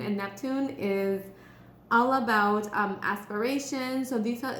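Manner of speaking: normal talking voice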